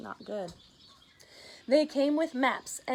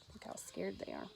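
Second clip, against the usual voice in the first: whispered aside; 13 dB quieter than usual. Real, not acted. The reader whispers to the kids about the scared penguins. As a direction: lean in and whisper.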